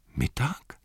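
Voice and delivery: soft, breathy questioning echo; puzzled echo. Acted. Sam echoes Frodo, soft bewildered question. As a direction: repeat it under your breath, bewildered. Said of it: "sagte Sam und versuchte nachzurechnen".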